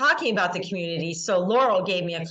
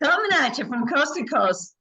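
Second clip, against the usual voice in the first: higher, laughing announcer repeat; repeating louder and sillier; 6 semitones higher than usual. Shown as a demonstration. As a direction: giggle through a big sign-on. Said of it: "It gets louder each time".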